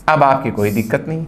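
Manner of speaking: ordinary talk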